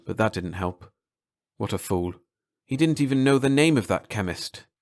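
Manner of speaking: usual speaking voice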